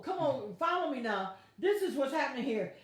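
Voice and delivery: normal talking voice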